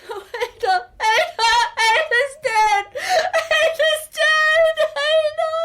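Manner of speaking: very high, sobbing cry; grief-stricken wail. Acted. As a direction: wail loudly over the dead bride. Groom wails over dead bride.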